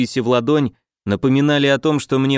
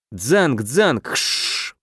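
sword clash sounds; much slower than usual. Acted.

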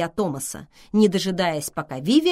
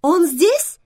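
high, loud, gladly hopeful question; light up on a rising question; delighted; 13 semitones higher, 9 dB louder than usual. A narrator's reading. Vivian learns the man is here, asks in delight. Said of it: "обрадовалась Вивиан".

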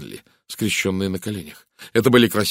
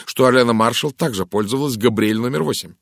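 louder, higher, pointed argument; sharp retort; 4 semitones higher, 7 dB louder than usual. A narrator's reading. Rosamund reminds Poirot about the perfume, sharp and forceful. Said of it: "резко заметила Розамунд".

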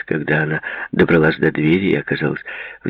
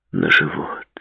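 ordinary delivery, nothing marked

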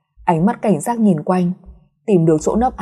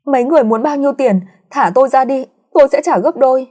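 panicked pleading; 6 semitones higher, 3 dB louder, faster than usual. Acted. Captive girl begs her attackers.

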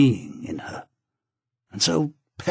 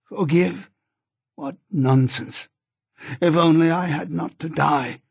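higher, raised in bitter protest; crying out; 6 semitones higher than usual. A narrator's reading. Dying wife to husband, bitter and raised. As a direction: cry it out from the deathbed. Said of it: "she cried".

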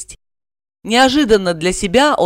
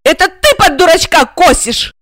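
high, loud frantic yell; frantic scream; 11 semitones higher, 6 dB louder than usual. A narrator's reading. Regina screams at Igor in a frenzy, high and loud. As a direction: scream it at him wildly. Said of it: "неистово закричала она".

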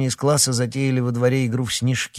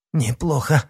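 puffing; 5 semitones higher than usual. A narrator's reading. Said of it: "пропыхтел Никита".

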